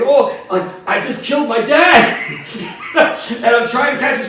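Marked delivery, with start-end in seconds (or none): 0.0-2.2 higher, louder alarmed inner cry of shock; panicked thought. Retold or imitated. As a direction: replay the moment of horror, half laughing. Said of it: "it's like".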